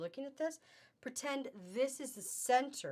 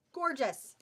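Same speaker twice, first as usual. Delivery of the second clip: higher, louder, emphatic praise; emphatic admiration; 4 semitones higher, 5 dB louder than usual. Real, not acted. Seller praising blue fabric to shoppers, emphatic admiration. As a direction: punch it out with admiration.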